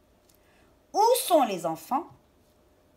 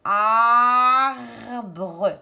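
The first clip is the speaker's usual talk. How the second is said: ARBRE as a long AAR, then BRE; drawn-out syllables; 5 dB louder than usual. Shown as a demonstration. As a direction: hold the first A of ARBRE. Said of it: "Est-ce que j'entends A".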